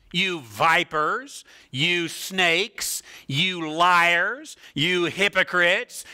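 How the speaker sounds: emphatic denunciation, insults slowly drawn out; condemning Jesus. Acted. Preacher quotes Jesus rebuking religious leaders, slow, forceful condemnation. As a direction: hurl each charge, building in force. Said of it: "filled with condemnation".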